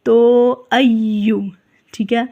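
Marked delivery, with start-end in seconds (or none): 0.7-1.4 slow ایو with the ya held and doubled. Shown as a demonstration. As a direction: press the ya in ایو with shadda. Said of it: "یا کے اوپر شد ہے". (ایو میں یا پر تشدید)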